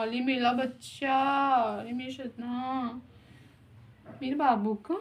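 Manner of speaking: soft, low doting coo. Real, not acted. Grandmother softly cooing over the baby. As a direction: hush the little one lovingly.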